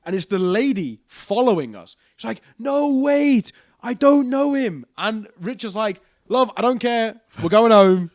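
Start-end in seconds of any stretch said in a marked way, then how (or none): 2.6-4.9 high, loud, slow pleading woman's voice; pleading lady. Retold or imitated. Imitating the drunk lady begging them to wait, high and loud. 6.3-8.1 loud, raised, higher telling off; firm telling off. Retold or imitated. Rich telling the following lady to go away, loud and firm.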